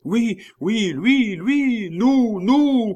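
very high, loud excited cries; many voices crying. A narrator's reading. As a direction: shout like a jubilant crowd. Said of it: "crièrent vingt voix d'hommes". (clameur aiguë de foule)

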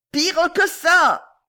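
very high, loud, drawn-out yell; shouting. A narrator's reading. Boy yelling into the old lady's ear, shouting. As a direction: holler it slowly into a deaf ear. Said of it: "cria le jeune homme dans l'oreille".

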